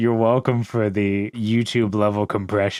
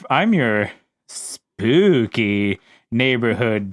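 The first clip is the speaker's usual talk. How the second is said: slow spooky drawl; much slower than usual. Acted. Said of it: "spook guy".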